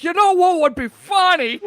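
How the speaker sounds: excited idea. Retold or imitated. Recalling why a different voice was picked, loud and amused. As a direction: blurt out a funny idea, amused. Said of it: "a totally other, different voice".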